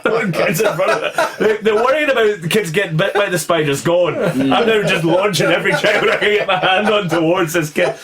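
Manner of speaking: normal talking voice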